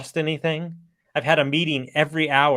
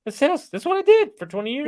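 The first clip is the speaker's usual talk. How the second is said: higher, faster cocky boast; excited boast; 13 semitones higher, 3 dB louder, faster than usual. Retold or imitated. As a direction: toss it off, sure of yourself. Retold boast, pitch jumps high.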